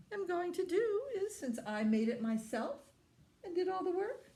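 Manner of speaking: self-righteous hen. A narrator's reading. Hen announces she keeps the bread.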